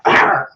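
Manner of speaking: low attack roar; mock monster roar; growling attack cry.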